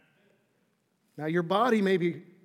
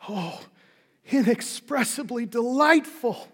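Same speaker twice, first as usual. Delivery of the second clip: put-on old preacher's raised, drawn-out exclamation; overcome with emotion; 6 semitones higher, 4 dB louder, slower than usual. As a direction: cry it out slowly, higher, moved by joy. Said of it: "exclaimed in a voice faltering with emotion".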